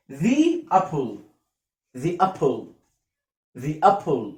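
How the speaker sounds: "The apple" with The as "thee"; teacher models "The apple" as thee-apple, falling; "thee" before vowel. Shown as a demonstration. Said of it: "ذي لما يجي قبلها حرف فاول". (نطق "The" ذي قبل "apple")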